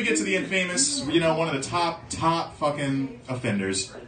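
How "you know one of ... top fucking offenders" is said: ordinary talk, nothing marked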